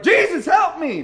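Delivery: high, full-out panicked shout; desperate cry for help. Acted. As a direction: yell as if going under. Peter crying out as he sinks.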